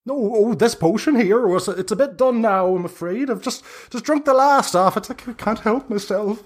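put-on high squeaky old hag calling down from the trees; high-pitched busy hag. Hag talks to the players.

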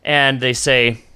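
ordinary talk

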